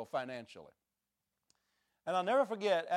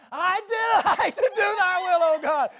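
very high, full-throated shout near a cry; top of the voice; 13 dB louder than usual. Retold or imitated. Preacher hollering the verse in the car. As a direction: belt it out as loud as you can. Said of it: "I started at the top of my voice".

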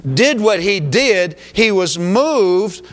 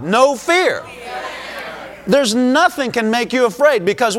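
fervent preaching; 5 semitones higher than usual. Acted. Pastor rousing the congregation, fervent and raised. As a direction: preach it with mounting fire. Preaching rises to full force.

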